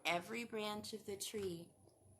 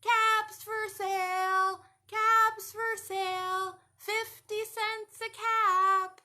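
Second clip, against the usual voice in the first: loud, slow street cry; hawker's drawn-out shout; peddler yelling; 10 semitones higher, 12 dB louder, slower than usual. Acted. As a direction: call out your wares down the street. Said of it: "he yells out".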